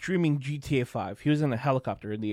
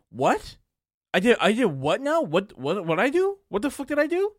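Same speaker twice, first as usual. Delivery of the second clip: high, rising mock-bewildered questions; confused; 8 semitones higher, 3 dB louder than usual. Streamer accused by her viewers, imitated rising confusion. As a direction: act out baffled disbelief, climbing higher. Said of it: "he was so confused. He was like".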